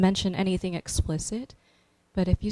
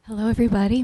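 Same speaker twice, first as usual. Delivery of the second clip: ordinary talk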